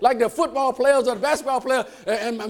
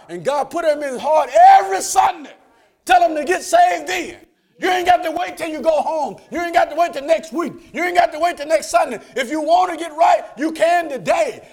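louder, higher shouted preaching; preaching at full force; 7 semitones higher, 7 dB louder than usual. Acted. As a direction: hammer it home to the congregation.

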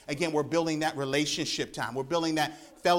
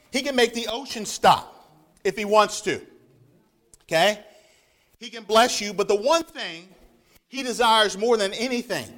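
raised preacher's voice, pressing each point; fervent preaching; 3 semitones higher, 3 dB louder than usual. Acted. Preacher pressing the church about God's one desire. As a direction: preach it, leaning on every line.

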